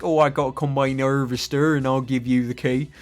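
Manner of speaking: hammed-up English country accent; mock English yokel accent; English regional accent. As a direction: put on a comic rural English accent. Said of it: "some of the English ones".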